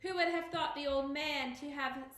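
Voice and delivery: usual speaking voice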